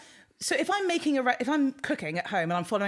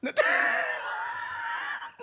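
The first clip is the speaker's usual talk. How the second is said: big shrieking laugh. Real, not acted. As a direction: burst out laughing high. Laughs at vegan question.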